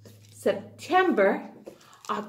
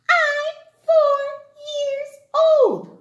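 put-on child voice; 14 semitones higher, 4 dB louder, slower than usual. Child answers.

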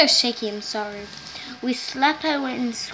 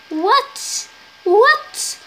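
rising, ever higher squeals of disbelief; shocked repeated what; 9 semitones higher, 8 dB louder, much slower than usual. Real, not acted. Kid outraged at the giveaway rule, shrieking what.

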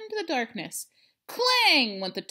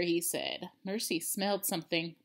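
usual speaking voice

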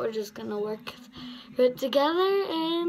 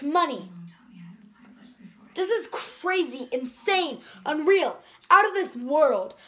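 bold spoken-word delivery, a touch louder; slam poetry recitation; 2 semitones higher, 4 dB louder than usual. Acted. Girl performing her slam poem for viewers, earnest.

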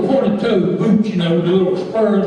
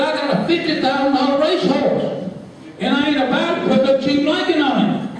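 higher, boastful, half laughing; bragging cowboy; 8 semitones higher than usual. Acted. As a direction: show off the fifty-thousand-dollar horse. Cowboy bragging about racehorse.

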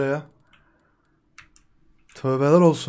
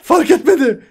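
giddy, higher and louder; gleeful; 11 semitones higher, 10 dB louder than usual. Real, not acted. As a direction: say it with giddy glee. Gleeful after escape.

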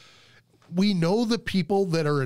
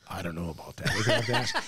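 low, chuckling reply; laughing doubt; 7 semitones lower, 3 dB quieter, much faster than usual. Retold or imitated. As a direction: hold back a laugh while doubting. Al's sly doubtful reply, laughing.

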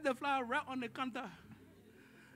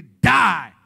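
a sudden loud blast on died; shouted; 3 semitones lower, 17 dB louder than usual. Real, not acted. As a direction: slam the news down hard. Climax of cautionary story.

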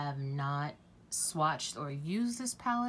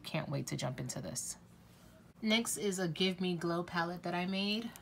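ordinary delivery

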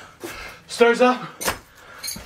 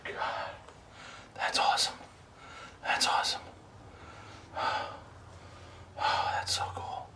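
hushed unvoiced whisper; 16 dB quieter than usual. Real, not acted. Whispering so nothing hears. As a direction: breathe the words out, barely audible.

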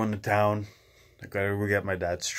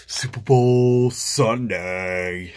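slow, loud stretched-out roar; drawn-out bellow; 7 dB louder, much slower than usual. Acted. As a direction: bellow it like a stadium announcer. Hype chant, low bellow.

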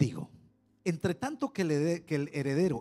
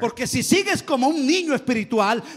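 loud, high, urgent pulpit warning; preaching at full force; 8 semitones higher, 8 dB louder than usual. Acted. Preacher warns the congregation, loud and high. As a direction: drive the warning home.

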